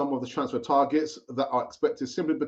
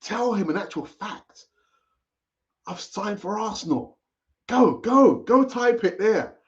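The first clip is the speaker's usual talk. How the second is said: imagined cousin; 5 semitones higher than usual. Retold or imitated. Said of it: "And he might say to you".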